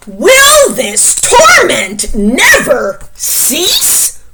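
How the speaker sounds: loud, high, slow anguished wail; hammed-up despairing wail; wailing bear. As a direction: moan it out like a tormented bear. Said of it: "wailed Bear".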